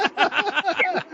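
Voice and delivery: sudden high loud laugh; loud burst of laughter. Real, not acted.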